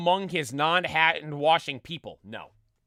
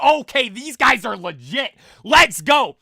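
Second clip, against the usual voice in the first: hyped cheer; 5 semitones higher, 14 dB louder than usual. Retold or imitated. Fans in front hyped up.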